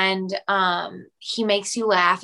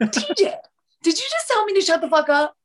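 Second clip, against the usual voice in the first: mock shocked outrage; 8 semitones higher, faster than usual. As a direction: gasp and go much higher, teasing mock outrage at the little brother.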